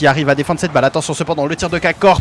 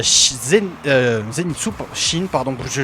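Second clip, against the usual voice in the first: tripping over players' names; false starts and fillers while correcting yourself; stammering; 2 semitones lower than usual. Real, not acted. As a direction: fumble the names, then apologise. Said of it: "je bégaye totalement".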